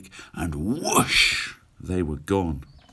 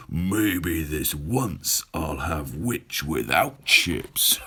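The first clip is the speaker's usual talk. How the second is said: slow, low, sly dragon voice; the dragon gloats before his feast; gloating dragon; 5 semitones lower, slower than usual. Acted. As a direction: savour each word like a hungry dragon. Dragon gloats over the witch.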